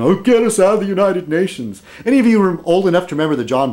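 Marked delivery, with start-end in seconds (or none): 0.0-1.8 mocking whiny libertarian. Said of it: "People like Ron Paul".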